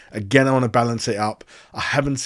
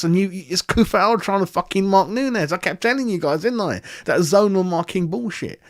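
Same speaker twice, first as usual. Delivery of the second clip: higher-pitched, worked-up complaining; heated rant; 6 semitones higher than usual. Real, not acted. Fan berating the club's defenders, heated higher rant. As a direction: vent about the defending, pitch rising.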